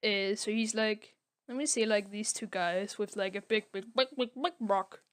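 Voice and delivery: ordinary talk, nothing marked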